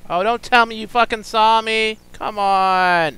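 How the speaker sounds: high, loud exasperated shout; frustrated yell. Real, not acted. Gamer yelling as the pig spots him. Spotted by the animal again.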